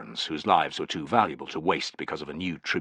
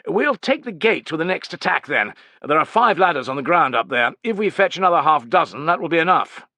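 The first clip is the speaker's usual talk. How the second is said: high, loud officer's voice; pitched-up commanding tribune; haughty young commander; 9 semitones higher, 6 dB louder than usual. A narrator's reading. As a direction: lay down orders in a raised, imperious voice.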